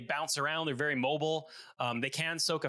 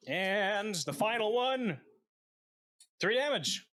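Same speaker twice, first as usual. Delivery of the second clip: drawn-out build-up to a higher reveal; dramatic announcement; 5 semitones higher, slower than usual. Real, not acted. Player reveals the last damage roll to the table, dramatic announcement. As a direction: announce it like a drumroll payoff.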